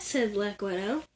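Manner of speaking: ordinary delivery, nothing marked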